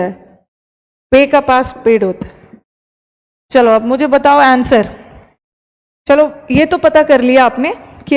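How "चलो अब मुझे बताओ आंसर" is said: normal talking voice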